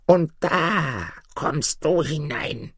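high, very drawn-out crone threat; old woman calling. A narrator's reading. Bush mother opens door for boy, high slow threat. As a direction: call it slowly and high, without shouting. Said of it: "Sie öffnete eine weitere Türe und rief".

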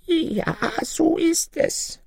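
put-on very high, drawn-out old woman voice; high old granny, stretching the words; ancient little granny. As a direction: pitch it way up like a tiny old crone. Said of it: "sprach die Alte".

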